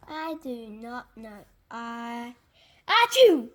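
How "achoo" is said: big explosive achoo; loud sneeze. Acted. Gerald sneezing on his friend Piggy, loud achoo. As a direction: burst out in a huge sneeze.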